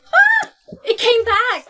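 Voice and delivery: high shriek of surprise with a laugh; startled shout. Acted. Startled yelp as the mark reappears. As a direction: yelp as the mark comes back.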